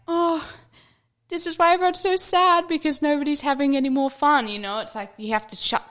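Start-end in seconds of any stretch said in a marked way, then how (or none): 0.1-4.5 high, louder put-on lament; mock exclamation. Mocking how adults lost their fun, high put-on lament. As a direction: exaggerate it like a dramatic sigh of realisation.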